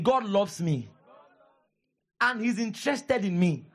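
preacher leading the church to repeat; call to repeat. Shown as a demonstration. Pastor to church, leading a line to repeat. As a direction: give it out for the congregation to echo. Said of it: "Say it again".

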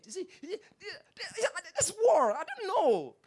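a hammed-up gossip getting worked up; mock drama. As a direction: stir up the drama.

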